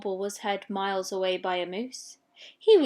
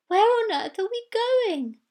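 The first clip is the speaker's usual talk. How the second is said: high, wondering question; little walrus asking; 11 semitones higher, 5 dB louder than usual. Acted. Littlest walrus asks the leader, high. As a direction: ask nervously.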